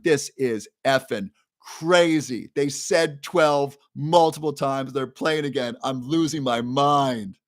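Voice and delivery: slow, drawn-out disbelief; exasperated. Retold or imitated. Alone in his RV at 2am, slow exasperated grumbling. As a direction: let the frustration stretch out each word.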